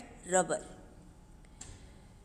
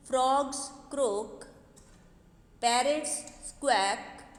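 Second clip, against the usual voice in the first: poem recitation; much slower than usual. Acted. Teacher reading the poem's last verse to her pupils, paced. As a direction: read each animal line out slowly and clearly.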